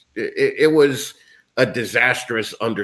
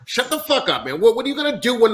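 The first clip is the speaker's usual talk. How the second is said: exasperated outburst; 10 semitones higher, faster than usual. Real, not acted. Ranting at people bored in lockdown, exasperated high-pitched outburst. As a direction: pitch jumps way up on shut the fuck up.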